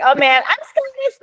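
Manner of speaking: very high, mock-excited gush; gushing American enthusiast. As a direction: imitate an overexcited tourist claiming kinship. Imitating gushing Americans claiming Scottishness.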